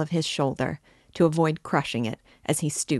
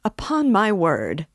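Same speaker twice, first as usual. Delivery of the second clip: raised, higher exclamation, drawn out; indignant cry; 5 semitones higher, 6 dB louder, slower than usual. A narrator's reading. Luzhin to the others, crying out indignantly. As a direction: cry out in slow protest. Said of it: "cried Luzhin".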